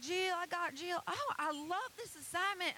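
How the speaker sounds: put-on high, loud Tennessee-accented gushing; excited Southern lady. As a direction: gush like a thrilled Tennessee grandma. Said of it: "she's like / so excited".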